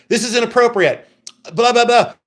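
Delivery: mock an offended man's complaint; mock indignation. Woman imitating a man objecting to her receipt, mock indignant. Acted quote of a scolding man.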